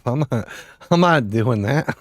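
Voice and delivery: ordinary talk, nothing marked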